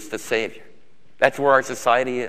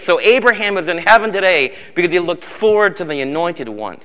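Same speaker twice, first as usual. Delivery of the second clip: full-force preaching; 3 semitones higher, 4 dB louder than usual. Acted. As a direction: build the crowd with rising fervor.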